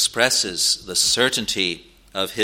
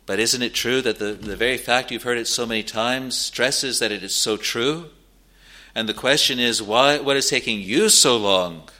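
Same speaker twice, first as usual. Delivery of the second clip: normal talking voice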